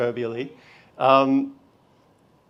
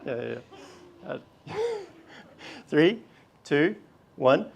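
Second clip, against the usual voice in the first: ordinary talk, nothing marked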